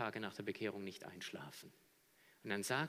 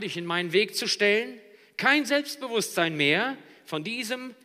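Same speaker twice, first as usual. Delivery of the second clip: loud, high sermon delivery; full-force preaching; 8 semitones higher, 11 dB louder than usual. Real, not acted. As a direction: hammer the picture home. (predigen mit voller Kraft)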